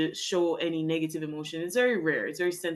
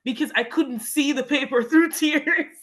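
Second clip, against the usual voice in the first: high, loud embarrassed laugh; a real laugh breaking into the line; laughing through it; 5 semitones higher, 5 dB louder than usual. Real, not acted. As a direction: laugh it out, higher and louder. Laughs while recalling her tears.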